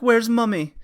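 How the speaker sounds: put-on high, small child's voice asking for mum; a young British kid asking where his mother is; little British boy. Son looking for his mum, high childlike voice. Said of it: "He's like".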